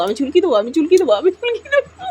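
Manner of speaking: high, fast teasing singsong; squeaky mock threat to a pet; playful squeal.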